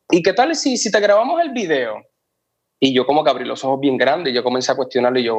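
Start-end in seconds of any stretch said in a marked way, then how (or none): 0.1-2.0 higher, teasing lilt on the offer; lightly voiced friend tempting him; imitating Dani's proposal. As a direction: pitch the question up like a friend springing a surprise.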